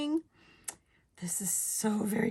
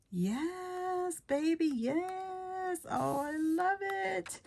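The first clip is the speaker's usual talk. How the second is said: delighted squeal; 10 semitones higher, much slower than usual. Real, not acted. Crafter thrilled by the teal colorway, delighted squeal. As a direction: croon it high and slow with delight.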